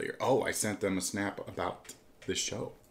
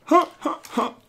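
fake laugh; 9 dB louder than usual. Mock laugh.

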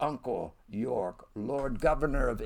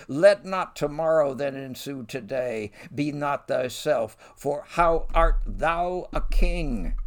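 impassioned verse reading; 2 semitones higher, 4 dB louder than usual. Acted. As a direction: plead and warn with rising passion. York's protest read aloud.

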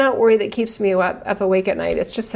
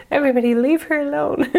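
raised, laughing protective plea; protective mom plea; 6 semitones higher, 5 dB louder than usual. Retold or imitated. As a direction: shoo everyone away from her. Protective mock plea for daughter.

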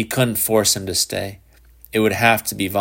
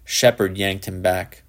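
ordinary delivery

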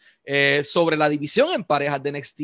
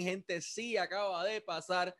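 ordinary talk, nothing marked

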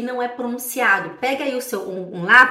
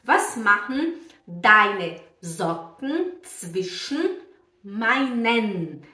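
very slow German, word by word; careful teacher pronunciation, slowly; slow model reading; much slower than usual. Shown as a demonstration. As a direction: space out each word for learners.

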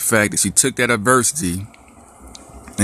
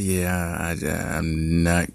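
much softer, hesitant and halting; sheepish mumble; 3 semitones lower, 5 dB quieter, much slower than usual. Retold or imitated. Daughter admitting to dad she's not going, soft and halting. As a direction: trail off like a kid who knows she's in trouble.

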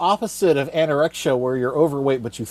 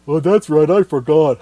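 the thick henchman realising his mistake; dumb henchman voice; 4 dB louder than usual. Acted. Dim henchman answers his boss.

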